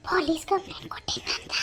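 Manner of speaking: whispered astonished question; shocked whisper. Acted. Friend whispers back in shock at the gossip.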